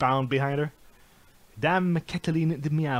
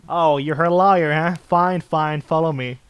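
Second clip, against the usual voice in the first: louder grudging jailer; guard giving in; lightly voiced gruff guard; grumbling guard; 3 semitones higher, 8 dB louder than usual.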